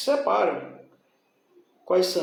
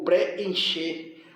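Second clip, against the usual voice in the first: slow "preencher", pre and en apart; separated vowels. Shown as a demonstration. Teacher showing equal vowels separate, "preencher" slowly divided. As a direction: draw out "preencher" with both Es distinct. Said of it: "Sempre separam".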